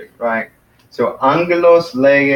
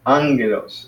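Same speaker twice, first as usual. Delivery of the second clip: ANgelos, weight on the opening syllable; first-syllable stress. Shown as a demonstration. Teacher showing the accent is on the front of "angelos", first syllable stressed. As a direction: stress the AN in "angelos" and let the rest fall. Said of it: "accent is on the first syllable".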